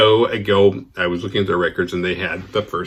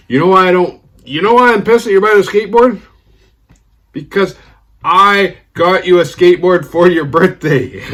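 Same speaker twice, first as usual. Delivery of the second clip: hurt friend; 9 semitones higher, 8 dB louder than usual. Retold or imitated. Friend revealing he built a birthday skateboard. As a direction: pour out the hurt, slowing at the end.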